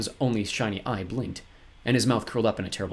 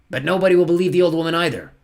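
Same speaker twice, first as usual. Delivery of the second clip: loud, higher impatient villain; 8 semitones higher, 9 dB louder than usual. A narrator's reading. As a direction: brush the objection aside loudly. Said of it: "said impatiently".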